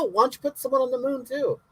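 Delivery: ordinary talk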